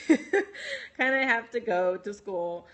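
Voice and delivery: slow, drawn-out repeat through a laugh; amused, laughing slowly over the line. Real, not acted. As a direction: chuckle and stretch the words out. Laughs while repeating her own reply.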